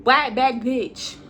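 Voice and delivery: ordinary talk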